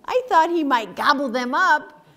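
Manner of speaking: ordinary delivery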